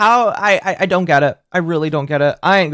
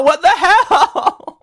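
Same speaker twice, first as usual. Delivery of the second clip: laughing; much slower than usual. Real, not acted. Laughing disbelief.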